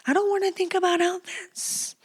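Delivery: high, soft little whine; whiny protest. Acted. Whining about end-of-school chores.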